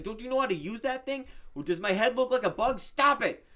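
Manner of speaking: high-pitched, loud, exasperated scolding; T-Bone, angry. Retold or imitated. Villager scolds player for hitting him with net, angry. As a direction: act out a villager fed up with being hit, voice way up. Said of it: "he got an angry look".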